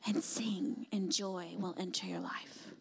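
hushed whisper over the worship; soft prayerful whisper. Real, not acted. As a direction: breathe it out in a whisper. Quiet worship moment, unvoiced.

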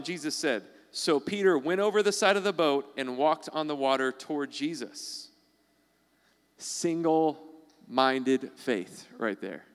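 normal talking voice

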